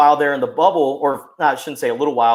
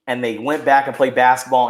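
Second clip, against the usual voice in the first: normal talking voice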